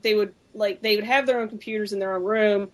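ordinary talk, nothing marked

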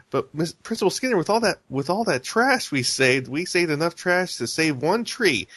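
high, eager schoolgirl voice; upbeat little girl. Retold or imitated. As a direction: chirp it like a hopeful kid. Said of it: "Lisa's trying to be the positive one".

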